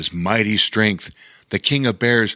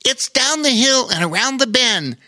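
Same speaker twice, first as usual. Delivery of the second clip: much higher, eager little spider; excited spider; 13 semitones higher than usual. Acted. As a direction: point the way, thrilled. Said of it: "said the spider excitedly".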